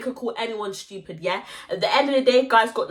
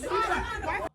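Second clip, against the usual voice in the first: high, slow taunting put-on voice; mock shout; 11 semitones higher, 6 dB quieter, slower than usual. Teller mocking her own threat, high taunt. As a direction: ham up the threat.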